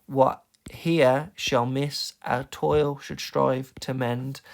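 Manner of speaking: "What HERE shall MISS", slow, beat by beat; stress on the dum. Shown as a demonstration. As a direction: slowly punch every second syllable to "to MEND". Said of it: "see how the focus of each line".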